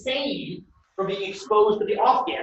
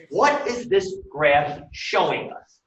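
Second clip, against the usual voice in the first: slowly spaced-out question; measured teacher's repeat, unhurried; slow, deliberate; 2 semitones lower, slower than usual. Real, not acted. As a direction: put the question word by word.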